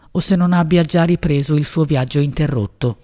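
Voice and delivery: ordinary delivery, nothing marked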